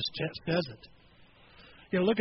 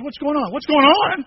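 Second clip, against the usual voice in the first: louder and higher nagging; pestering question; 6 semitones higher, 10 dB louder than usual. Retold or imitated. Someone pestering a discouraged friend, mildly loud. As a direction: push the question at them. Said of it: "somebody says".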